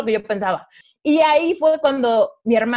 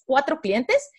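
ordinary delivery

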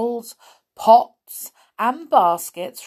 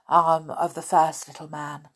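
plain reading voice

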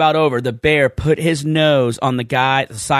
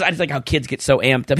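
normal talking voice

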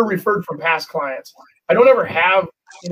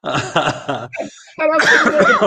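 laughing; 8 semitones higher, much slower than usual. Real, not acted. Laughing while telling.

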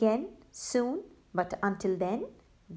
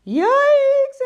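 high, loud yelp of fright; loud scared yikes; frightened yelp; 13 semitones higher, 10 dB louder than usual. Acted. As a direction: jump out of your skin. Robbers terrified by the burst-in.

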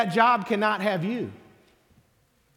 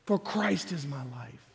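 lower, softer repeat of "For Christ is my life."; soft echo; 6 semitones lower, 5 dB quieter than usual. Shown as a demonstration. Preacher echoing the line to himself, softly. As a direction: let it fall back, quiet and inward.

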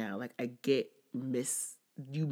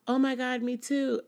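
high, loud gushing "oh my God"; high excited squeal, a little flirty; 11 semitones higher, 6 dB louder than usual. Retold or imitated. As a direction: squeal it like you just found a soulmate. Excited over shared sign.

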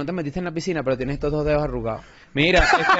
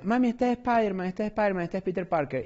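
put-on little boy; 4 semitones higher, 6 dB quieter than usual. Boy showing his mom the Funko, higher and soft. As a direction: play the kid pointing at the figure, higher and softer. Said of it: "el niño decía".

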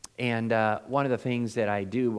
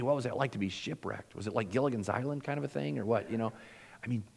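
normal talking voice

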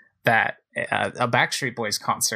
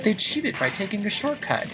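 high-pitched playful outrage; indignant; 9 semitones higher than usual. Real, not acted. Player passed by shortcut-taking rivals, indignant complaint. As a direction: protest the cheating, voice pitched up.

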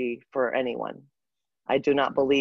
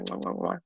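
blowing kisses; 8 semitones lower, 7 dB quieter than usual. Real, not acted. Saying goodbye to friend Leah.

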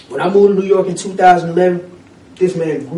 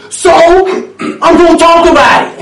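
loud, higher, strained defiance; defiant declaration; 10 semitones higher, 9 dB louder than usual. Retold or imitated. Voicing Bernie vowing to speak out, loud defiance. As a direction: belt it out as a vow.

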